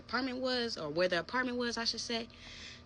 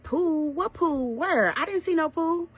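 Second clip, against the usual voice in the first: much higher, louder disbelieving shout; incredulous outburst; 12 semitones higher, 9 dB louder, slower than usual. Retold or imitated. She hears he slept by the pool, incredulous shout. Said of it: "So I'm like".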